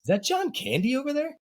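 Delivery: lightly imitated Joe Montana, high and offhand; casual aside. Joe Montana in the Super Bowl huddle, mock casual, high pitched. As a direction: ask it like nothing's at stake. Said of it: "looks past and says".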